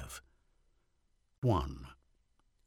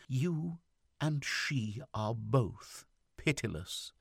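higher, drawn-out reproach; slow words, weighing each one, higher voice; slow and emphatic; 6 semitones higher, slower than usual. A narrator's reading. Wife reproaching her husband for being pitiless, slow and emphatic. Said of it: "she said slowly, with a marked emphasis".

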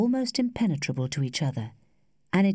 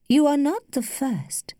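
slow, higher, slightly laboured delivery; a little laboured; 9 semitones higher, 4 dB louder than usual. A narrator's reading. Lady deals the young man a blow, a little labouredly. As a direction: place the words with a little effort. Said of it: "It left her lips a little labouredly".

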